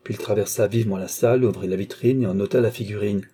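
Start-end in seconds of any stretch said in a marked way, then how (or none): none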